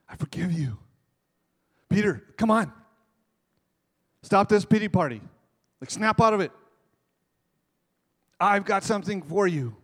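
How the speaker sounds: a hint of Jesus rousing Peter; voicing Jesus. Jesus voiced in retold story.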